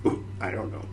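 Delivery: usual speaking voice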